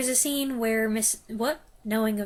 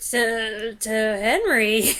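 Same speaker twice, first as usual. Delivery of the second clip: a hint of a lower, slower Emma, stretched out; lightly voiced Emma, drawn out and low; deeper voice for Emma; 7 dB louder, much slower than usual. Film reviewer quoting Emma in a put-on deeper voice. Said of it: "I don't know why I made her voice deeper".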